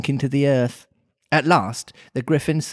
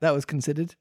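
ordinary delivery

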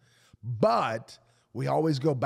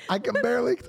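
high, strained sick voice; 11 semitones higher, 3 dB louder, much faster than usual. Retold or imitated. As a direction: play up being too sick to talk. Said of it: "I couldn't move".

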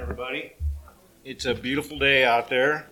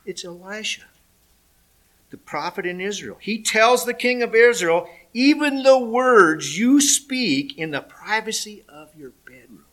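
officer's reply; 7 semitones higher, 5 dB louder than usual. Acted. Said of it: "one of the officers replied".

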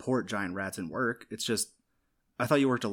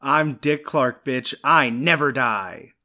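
loud, slow triumphant boast; booming boast; 4 semitones higher, 9 dB louder, much slower than usual. Acted. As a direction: announce yourself like a legend. Grand loud slow entrance boast.

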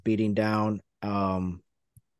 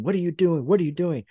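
mild mock yell, rising in pitch; 6 semitones higher, faster than usual. Miz scolding his tag partner, mild mock yell. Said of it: "The Miz is yelling at him".

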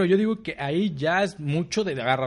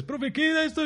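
high, pleading student question; whiny student voice; 11 semitones higher than usual. Retold or imitated. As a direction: ask like a clueless kid. Student voiced asking teacher.

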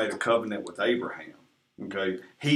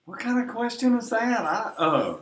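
high-pitched disbelief; incredulous high squeak; baffled; 11 semitones higher than usual. Real, not acted. Baffled by the question.

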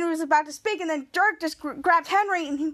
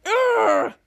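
loud, raised "ugh" growl; frustrated growl; 7 semitones higher, 5 dB louder than usual. Retold or imitated. Dirk refused a cash refund, frustrated growl. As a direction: let out an exasperated growl.

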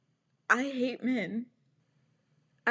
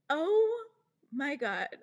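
stunned oh; 5 semitones higher, slower than usual. Real, not acted. Disbelief at his message.

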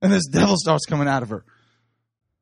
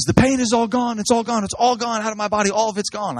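higher, excited retelling of the healed woman; imitated joy; 7 semitones higher than usual. Healed woman telling the crowd, higher and excited. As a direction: lift it up as the woman cries out healed. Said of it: "she says".

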